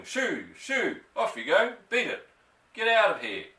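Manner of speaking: high, loud shooing at the birds; shooing shout. A narrator's reading. Sergeant waving his arms at the pheasants, shouting. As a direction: chase the birds off with your voice. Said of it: "waving his arms at the pheasants and shouting".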